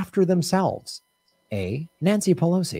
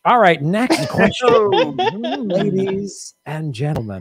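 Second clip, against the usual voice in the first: slow, stretched host call to the audience; game show announcer; 4 semitones higher, 4 dB louder, slower than usual. Acted. Quiz host calls the next question to the audience, slow and showy. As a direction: draw out the announcement like a showman.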